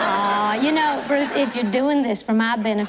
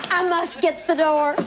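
ordinary talk, nothing marked

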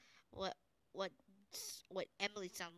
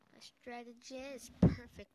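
slow loud boast; 3 semitones higher, 5 dB louder than usual. Acted. Boasting while playing game.

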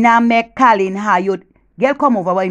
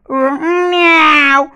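high, drawn-out growling meow; lion's roar; 6 semitones higher, 4 dB louder than usual. Acted. Woman showing Jenny how a lion goes, mock lion roar. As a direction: imitate a lion that comes out as a cat. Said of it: "You ever hear a lion roar and go".

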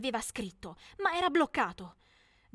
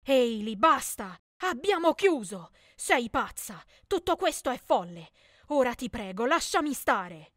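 loud, furious, pushing her away; angry outburst; 5 dB louder than usual. Acted. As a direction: snap at her to leave him alone. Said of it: "disse con rabbia". (sfogo rabbioso e forte)

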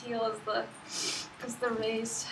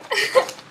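giddy laugh; 8 semitones higher, 5 dB louder than usual. Real, not acted. Opening her own book.

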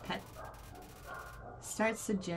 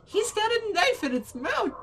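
real startled yell, much higher and louder; alarmed shout; 13 semitones higher, 9 dB louder than usual. Real, not acted. Player reacting to monster with knife, alarmed shout. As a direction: shout it high in sudden alarm.

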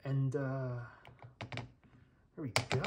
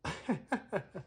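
light, staccato run of laughter; real giggle at the absurdity; amused chuckle; 4 semitones higher, 5 dB quieter than usual. Real, not acted. Amused by boiling phones.

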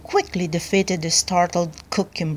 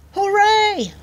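high, loud cheer; 13 semitones higher, 5 dB louder than usual. A narrator's reading. Prisoners freed from the tyrant, cheering. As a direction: cheer out jubilantly, pitched way up. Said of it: "cheered the prisoners".

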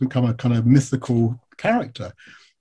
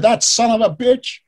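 higher, slow indignant outburst; imitated angry rant; 10 semitones higher, 4 dB louder than usual. Retelling the man's reply to a biographer, angry rant. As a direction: imitate an outraged man cursing slowly. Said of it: "He goes off on this rant".

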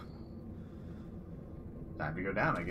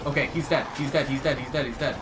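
ordinary talk

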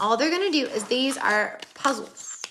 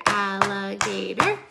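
slow, split into separate syllables; 2 semitones lower, slower than usual. Shown as a demonstration. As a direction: tap out each beat of alligator. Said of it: "count how many syllables".

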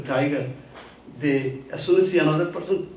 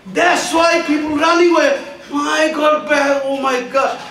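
frightened cry; 10 semitones higher, 5 dB louder than usual. Retold or imitated. Tiger terrified by the beating.

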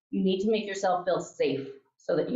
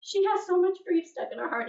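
urgent confiding; 12 semitones higher than usual. Retold or imitated. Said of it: "and I was like".

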